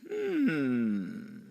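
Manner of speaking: ordinary delivery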